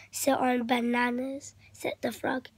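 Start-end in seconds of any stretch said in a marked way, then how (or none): none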